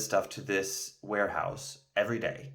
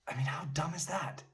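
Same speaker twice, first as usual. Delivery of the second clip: whispered aside; 7 semitones higher, 7 dB quieter than usual. Real, not acted. Mocking aside about quitting.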